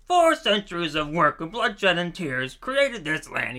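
super silly voice, high and loud; solemn speech declaimed in a goofy cartoon voice; Pumbaa. Pumbaa beside Mark Twain reciting serious history, super silly voice. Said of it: "He's talking in a super silly voice".

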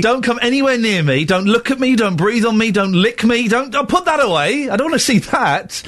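mock disgust. Recoiling from sick colleague.

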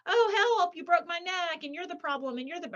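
high, whiny fake-hurt cry; a hint of a narcissist playing wounded; mock victim wail. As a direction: ham up a melodramatic victim. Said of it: "the narcissist is gonna say".

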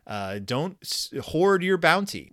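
ordinary talk

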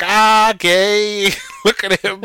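teasing jeer. Retold or imitated. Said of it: "And everyone's like".

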